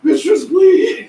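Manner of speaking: loud, slow pleading cry; pleading shout. Acted. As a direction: beg your mistress to come. Servant begging loudly.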